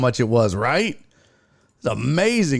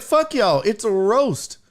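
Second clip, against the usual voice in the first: retold defiant comeback; 8 semitones higher, slower than usual. Retelling comeback to groaning crowd.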